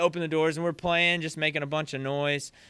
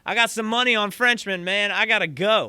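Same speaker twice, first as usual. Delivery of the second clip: raised, higher-pitched impatient protest; imitated yelling back; 5 semitones higher, 4 dB louder than usual. Drummer with another gig to get to, yelling back. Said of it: "he's just yelling back at him".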